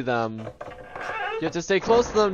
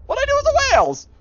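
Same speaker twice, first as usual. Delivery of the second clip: mock indignant; 5 dB louder, much faster than usual. Mock offended reply to pet.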